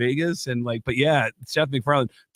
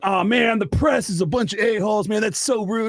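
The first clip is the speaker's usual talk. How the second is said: higher-pitched, indignant imitation of critics; retold public indignation, pitched up; mock outrage; 6 semitones higher than usual. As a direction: complain in a raised, mock-offended voice. Imitates outraged people reacting online.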